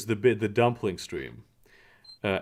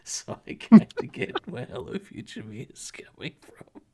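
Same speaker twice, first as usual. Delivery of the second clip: breathy whisper through a laugh; whispered aside; 5 semitones higher than usual. Real, not acted. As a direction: confide it under your breath. Joking aside after laughing.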